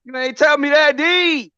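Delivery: high-pitched teasing disbelief; incredulous outcry. Real, not acted. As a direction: cry out high, calling a friend out. Teasing friend over his tournament loss.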